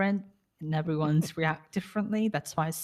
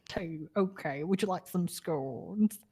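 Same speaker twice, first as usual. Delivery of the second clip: mock posh British; 3 semitones higher, 3 dB quieter than usual. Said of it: "we do this in our British accents".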